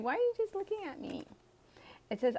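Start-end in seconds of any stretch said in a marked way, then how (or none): 0.0-1.2 high, quick teasing question. Retold or imitated. Aquarius teasing the staring admirer, high and quick. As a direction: playfully call them out for staring.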